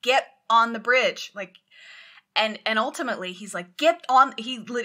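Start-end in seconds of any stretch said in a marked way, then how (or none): 0.0-1.3 officer shouting down the phone at the captain; imitated yelling. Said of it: "there's often yelling". 3.8-4.3 loud, higher yelled command; officer shouting at the cowardly captain; imitated shout. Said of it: "there's often yelling".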